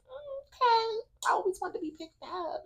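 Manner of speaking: high, soft little voice; coy mock reply. Woman reacting to being carried upstairs, coy and high. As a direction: go small and squeaky, playing coy.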